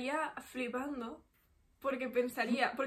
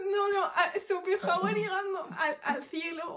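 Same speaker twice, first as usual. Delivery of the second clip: laughing; 4 semitones higher, slower than usual. Real, not acted. Laughing while telling the joke.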